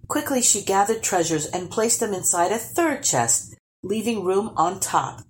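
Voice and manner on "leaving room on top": plain reading voice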